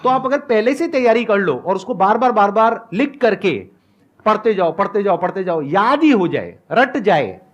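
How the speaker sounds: usual speaking voice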